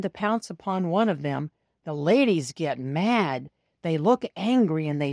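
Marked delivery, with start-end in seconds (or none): none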